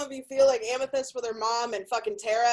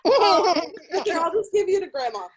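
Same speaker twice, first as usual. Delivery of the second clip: mom; 5 semitones higher, 4 dB louder than usual. Retold or imitated. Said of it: "had me and was like".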